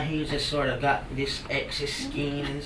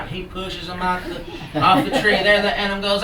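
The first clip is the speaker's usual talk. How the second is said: plain reading voice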